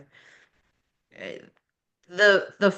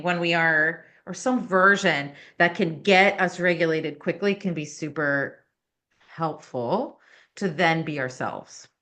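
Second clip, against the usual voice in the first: ordinary talk, nothing marked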